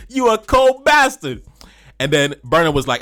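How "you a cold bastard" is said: high, slow, loud praise; admiring exclamation. Retold or imitated. Friend praising [name]'s verse, high slow exclamation. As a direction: drag it out impressed. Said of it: "He was like".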